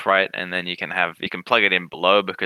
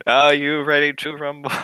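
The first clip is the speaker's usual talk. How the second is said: boxing ring announcer hype; 6 semitones higher than usual. Pretend. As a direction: call it like a fight announcer.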